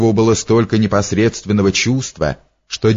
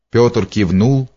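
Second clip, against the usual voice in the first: ordinary delivery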